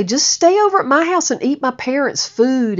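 high, teasing friend's invitation; a mock high coaxing voice; playful friend. Susan joking that she should stay over, high and teasing. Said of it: "she'd say".

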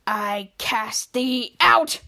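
low, slow mock-solemn banishing; [name], pointing at Mika, banishes her in a low, slow decree.

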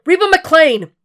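high, loud shout of her full name; calling out. A narrator's reading. Will searching the burning house for Reba, loud call. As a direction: yell it through the smoke. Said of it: "Will called for her as he ran".

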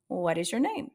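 ordinary talk, nothing marked

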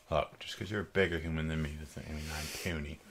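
ordinary talk

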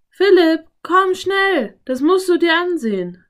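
high, loud, excited call; urgent high-pitched summons; calling from outside. A narrator's reading. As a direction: shout for someone to come and look. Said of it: "rief Anne von draußen".

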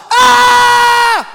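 big high open-throated shout. Shown as a demonstration. Preacher shows the congregation the battle shout. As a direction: let out one huge battle shout. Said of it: "I want everybody to shout".

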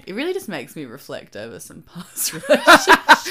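laughing while admitting it. Real, not acted. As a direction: chuckle through it. Laughs at own memory.

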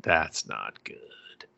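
a hint of a quietly horrified hero; dismayed. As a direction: mutter it out as it sinks in. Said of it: "he's just like".

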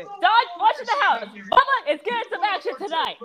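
high-pitched excited holler; hammed-up excitement. Character reacting to the gossip about Bubba, excited high crowing. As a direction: crow it high, half laughing.

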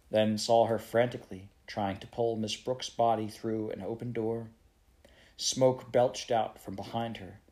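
ordinary delivery, nothing marked

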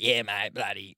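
Kiwi twang impression. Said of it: "New Zealand twang".

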